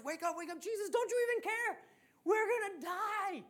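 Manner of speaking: high, hammed-up panicked yelling; freaking out. Disciples shaking Jesus awake in the storm, panicked. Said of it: "they're freaking out".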